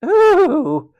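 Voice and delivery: high, loud mocking "ooh"; mocking coo. A narrator's reading. Matron teasing offended George, high mocking coo. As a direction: taunt him with a swooping coo. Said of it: "she added mockingly".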